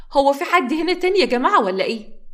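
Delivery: loud, high call under the bed. Acted. Samra peers under the bed and calls out loudly. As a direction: call out to anyone hiding. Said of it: "وهي بتنادي".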